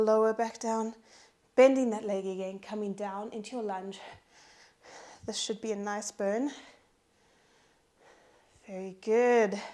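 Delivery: ordinary talk, nothing marked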